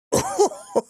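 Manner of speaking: short high-pitched chuckle. Acted. Old sage amused by Nanaki, high chuckle.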